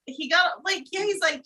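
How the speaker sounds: usual speaking voice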